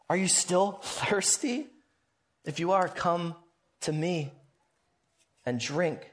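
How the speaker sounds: softening to a near hush as he invites; gentle invitation. Retold or imitated. Jesus inviting the thirsty crowd to drink, hushed and fading. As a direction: draw them in quietly, fading to almost nothing.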